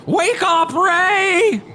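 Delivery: high, loud ghoul cry; ghoulish shout. Acted. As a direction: yell it out like a ghoul. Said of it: "in a ghoulish voice".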